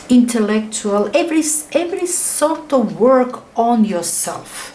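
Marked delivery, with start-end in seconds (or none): none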